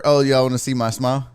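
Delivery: usual speaking voice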